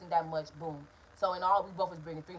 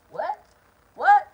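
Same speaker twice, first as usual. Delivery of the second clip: What? What? rising to a high, loud yell; incredulous shouted What; outraged shout; 9 semitones higher, 12 dB louder than usual. Retold or imitated. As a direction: yell it back in disbelief. Escalated outraged repeat of "What?.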